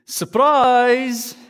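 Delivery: spring it on them like a party; excited surprise. Retold or imitated. Preacher voicing the angel's greeting, excited surprise. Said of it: "here's what he says".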